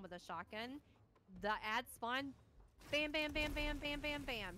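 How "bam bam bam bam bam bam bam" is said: gunshot sounds. Retold or imitated. Gun sound effects in tutorial.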